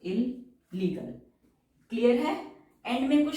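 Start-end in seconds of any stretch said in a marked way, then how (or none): 0.0-1.1 slow dictation. Shown as a demonstration. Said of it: "दो बार sound है तो दो बार एल बनेगा".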